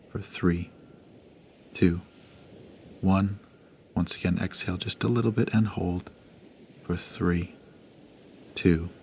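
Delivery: hushed, unhurried breathing guide; guided meditation. Acted. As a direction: gently guide the listener through each breath.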